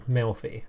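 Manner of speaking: Melfi said as two syllables, MEL-fee; corrected pronunciation. Shown as a demonstration. Player tries out the corrected card name, Melfi as MEL-fee. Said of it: "It's pronounced Melfi".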